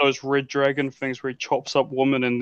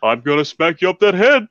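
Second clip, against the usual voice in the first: louder mock threat; gruff cartoon bear threat; 4 semitones higher, 5 dB louder than usual. Yogi threatening.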